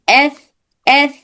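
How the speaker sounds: long hissed s for ایس repeated; sound demo. Shown as a demonstration. Teacher showing correct ثا sound. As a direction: hold the hiss on ایس. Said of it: "ایسی آواز نہیں آتی، بلکہ".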